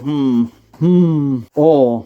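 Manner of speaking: low drawn hmm of a thinking cop; low musing officer. Retold or imitated. Officer Balls finding the note, low musing hums. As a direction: hum it like a puzzled detective. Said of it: "says Officer Balls".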